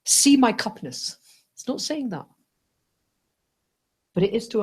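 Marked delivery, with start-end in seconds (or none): 0.0-1.2 loud, high hammed-up cup voice; voicing the cup. A cup introducing itself to us, mock loud greeting. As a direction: announce yourself proudly as a cup. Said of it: "This is not going".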